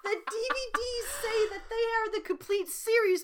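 high-pitched, worked-up ranting; comic outrage. Real, not acted. Host betrayed by "complete series" DVDs, comic outrage. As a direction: rail at being lied to. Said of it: "I'm so mad".

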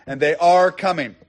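"are" leaned on as the key word; emphatic, insistent "are"; stress on ARE. Shown as a demonstration. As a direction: press firmly on "are" before "coming". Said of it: "and are is active".

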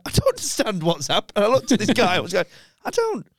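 mock baffled, voice jumping high; baffled disbelief. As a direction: act out total confusion. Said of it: "And I was like".

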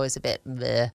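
normal talking voice

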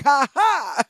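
loud, high mock "ha ha"; imitated laugh. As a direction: punch out a put-on laugh.

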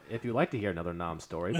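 ordinary talk

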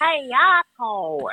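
put-on high, slow tiny-child voice; toddler voice. Mother imitating baby Ivy repeating the rude word in tiny voice. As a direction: drawl it out like a toddler. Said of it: "they had Ivy going".

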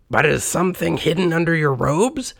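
high-pitched questioning character voice; Scrooge. A narrator's reading. Old miser asks the ghost about its robes, high and puzzled. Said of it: "said Scrooge".

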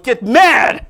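loud, high rallying cry; get mad shout. Shown as a demonstration. Preacher rallying the congregation to get mad, loud. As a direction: fire them up, slow and loud. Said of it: "Tell someone".

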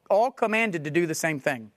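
ordinary talk, nothing marked

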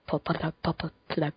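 stuck on the first syllable, softly repeating; stumbling over word. Real, not acted. Podcaster fumbling the name of the tab, tongue-tied. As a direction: sputter as if the word won't come. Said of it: "spit it out".

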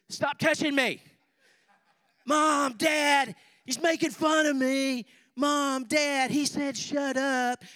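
put-on high, loud whining kids bickering in the back seat; high-pitched shouted tattling, kids in the car; whiny kids. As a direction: squeal like squabbling children tattling to mom and dad.

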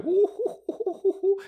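very high falsetto whoops; gleeful high "hoo-hoo-hoo"; giddy hooting. Retold or imitated. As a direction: hoot with glee in a string of short bursts. Retold giddy glee before date.

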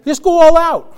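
preacher urging the congregation to go all out; shouted exhortation. Real, not acted. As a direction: call it out to the church.

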